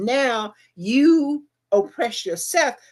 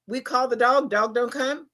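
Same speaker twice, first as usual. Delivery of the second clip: ordinary delivery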